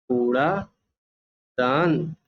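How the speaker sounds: कूड़ादान said very slowly, every syllable stretched; drawn-out word. Shown as a demonstration. Teacher repeats कूड़ादान slowly for pupils to copy. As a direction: draw out कूड़ादान so children catch it. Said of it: "मैंने आपको पहले भी बताया है".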